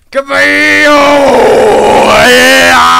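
loud, high victory yell; triumphant shout. Real, not acted. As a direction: cheer it out.

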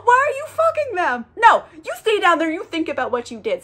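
high-pitched mock scolding; hammed-up indignant telling-off; God. God sends the angel away, upset and scolding. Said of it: "So God's upset with him".

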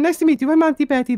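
usual speaking voice

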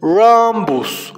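"Rhombus" stretched out clearly; drawn-out model to repeat. Shown as a demonstration. Teacher asking children to repeat "Rhombus", slowly drawn out. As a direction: draw out "Rhombus" for learners to echo. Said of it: "Repitan después de mí".

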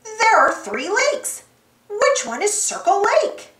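shouting. A narrator's reading. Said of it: "shouted Boots".

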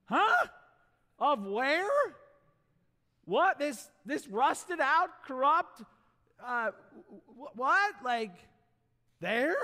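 high, loud disbelief; incredulous. Acted. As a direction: splutter in shock. Mock baffled reaction to Flint.

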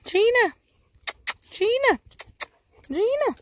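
high, loud call across the yard. Real, not acted. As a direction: call the dog back from far off. Calling dog from far.

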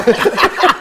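loud, high burst of laughter; real laugh. Real, not acted. Laughing at his own car-home story, loud real laughter. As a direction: crack up at the memory.

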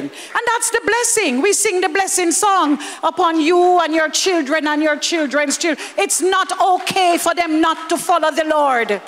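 usual speaking voice

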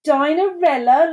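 high, loud, amused disbelief; mock laughing. A narrator's reading. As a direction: ask it as if the idea were laughable. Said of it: "laughed Doris".

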